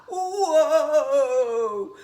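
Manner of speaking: loud startled whoa. Acted. Kev tips over on the raft, loud startled cry. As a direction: cry out as you wobble.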